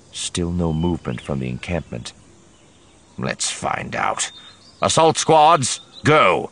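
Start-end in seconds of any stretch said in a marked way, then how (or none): 3.2-4.3 whispered resolve. Acted. Covell before the assault. 4.8-6.5 high, loud battle order; barked command. Acted. Covell orders the assault.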